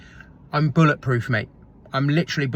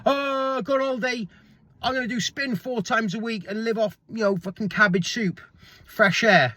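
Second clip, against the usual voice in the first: mock crash dieter; 6 semitones higher than usual. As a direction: mimic the naive fad dieter, higher. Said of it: "don't just go".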